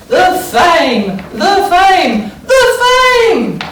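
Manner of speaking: building excitement. A narrator's reading. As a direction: build up the thrill with every repeat. Story build on the word fame.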